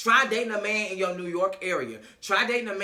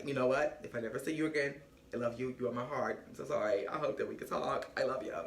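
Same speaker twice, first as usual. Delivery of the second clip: low, soft, tender imitation; hushed, low lovesick message; mock heartfelt farewell; 5 semitones lower, 8 dB quieter, faster than usual. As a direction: murmur a sorry goodbye, playing him. Retelling his sorrowful farewell video.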